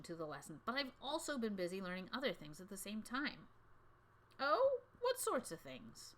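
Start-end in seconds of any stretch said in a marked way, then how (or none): 4.4-6.1 curious teacher. A narrator's reading.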